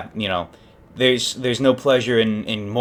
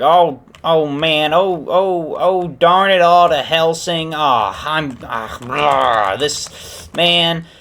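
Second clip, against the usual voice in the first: loud, higher groaning frustration; acted exasperation; 7 semitones higher, 4 dB louder, much slower than usual. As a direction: fume in mock dismay over a disaster. Family frustrated after wrecking car.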